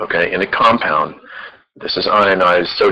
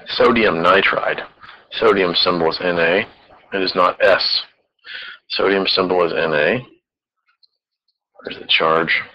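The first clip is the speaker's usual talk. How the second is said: ordinary talk, nothing marked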